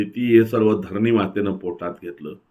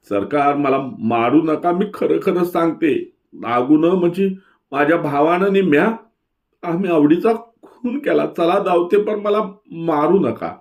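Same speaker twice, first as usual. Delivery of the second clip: much higher, frightened begging; terrified pleading; 8 semitones higher than usual. A narrator's reading. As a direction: beg the police not to hit her. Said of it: "बिचकत बिचकत चावडीवर आली आणि भेदरली".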